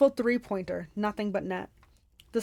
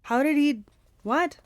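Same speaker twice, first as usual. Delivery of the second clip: a startled break from the reading, higher and louder; surprised; 5 semitones higher, 6 dB louder, slower than usual. Real, not acted. Reader startled mid-chapter, surprised. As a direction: caught off guard by the plot.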